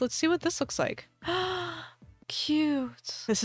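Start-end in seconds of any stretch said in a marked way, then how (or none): 1.2-1.8 quiet delighted gasp; soft gasp. Real, not acted. New dress reaction.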